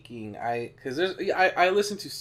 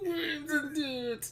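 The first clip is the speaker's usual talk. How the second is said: slow high sing-song name; drawn-out high; 13 semitones higher, much slower than usual. Acted.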